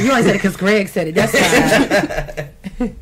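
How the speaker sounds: loud, quick, laughing tease; laughing, mock-offended ribbing; teasing through laughter. Real, not acted. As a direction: tease them loudly while cracking up. Teasing guest amid shared laughter.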